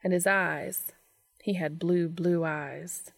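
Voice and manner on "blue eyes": ordinary delivery, nothing marked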